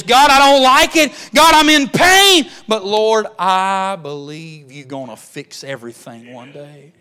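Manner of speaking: high, loud pleading prayer to God; loud, high anguished plea; anguished groaning prayer. Acted. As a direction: cry out to the Lord in pain but with hope. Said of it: "Groaning is".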